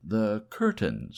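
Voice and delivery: usual speaking voice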